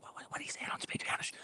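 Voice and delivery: confused whispering from the row behind; imitated audience whisper. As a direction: whisper it like a puzzled audience member. Confused audience members behind him.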